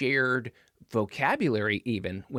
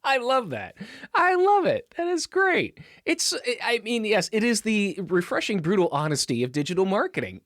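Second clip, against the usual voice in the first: delighted; 8 semitones higher than usual. Real, not acted. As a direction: squeal it with real delight. Delighted reaction to the joke.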